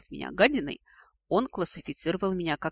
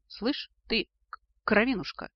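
higher voice, catching on the first sound; indignant stammer; 6 semitones higher than usual. A narrator's reading. Dragon to his cheeky descendant, stammering with indignation. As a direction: stammer with outrage. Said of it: "От возмущения Аргел стал заикаться".

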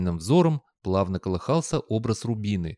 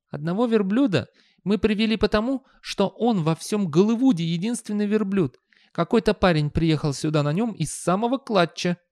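high, even youth voice; imperturbable youngster; 10 semitones higher than usual. A narrator's reading. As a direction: explain unperturbed in a high young voice. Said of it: "невозмутимо объяснил юнец".

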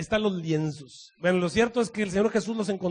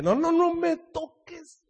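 slow high mock warning; 10 semitones higher, 3 dB louder, much slower than usual. Jesus stops Mary embracing him.

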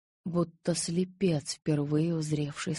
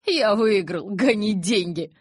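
loud, higher-pitched crowing; triumphant call; 4 semitones higher, 7 dB louder, slower than usual. A narrator's reading. Emmett calls from the house about a bet, triumphant. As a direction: call out your win to be heard. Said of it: "донёсся из дома голос Эммета".

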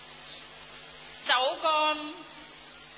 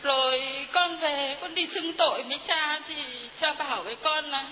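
ordinary talk, nothing marked

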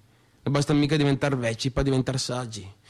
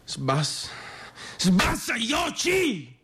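building to a shout; 3 dB quieter, slower than usual. Acted. A man losing his temper, building to a roar. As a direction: let it explode on the last oggi.

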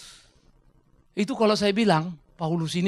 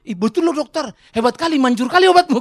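high, loud delighted praise; amazed patient; 5 semitones higher, 10 dB louder than usual. Acted. Patient praising the doctor after the injection, amazed. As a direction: gush at the doctor in mock wonder.

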